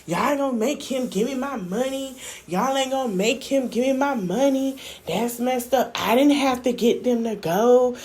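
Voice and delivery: mock his sulky complaint; imitating Chef D complaining. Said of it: "He's like".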